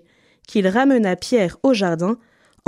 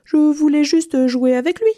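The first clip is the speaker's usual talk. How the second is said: high-pitched innocent excuse; mock-innocent cat; 8 semitones higher, 4 dB louder than usual. A narrator's reading. Cat claiming it only wanted to play, high and innocent. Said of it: "Le chat prit un air innocent".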